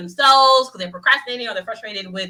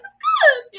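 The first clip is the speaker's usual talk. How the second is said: GOOD squeaked very high and drawn out; emphasis on last syllable; 7 dB louder than usual. Shown as a demonstration. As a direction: push the word good way up, louder. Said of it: "last syllable there".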